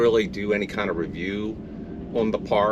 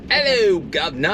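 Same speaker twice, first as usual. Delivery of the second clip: high, hammed-up British "Hello, governor"; mock British accent; 7 semitones higher, 3 dB louder than usual. Husband greets wife in mock British accent. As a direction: play at being a cheery Brit. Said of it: "British accent now 'cause the Brits are talking".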